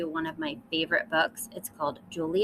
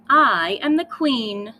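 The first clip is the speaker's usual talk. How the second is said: loud and slow, I am the queen; proud queen declaration; 4 semitones higher, 8 dB louder, slower than usual. Acted. Lily proclaims herself queen once more, loud and slow. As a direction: declare it like a haughty little queen.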